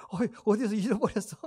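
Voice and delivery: playful pretend question through laughter; laughing. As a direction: tease lightly, chuckling through the words. Teasing listener about losing a child.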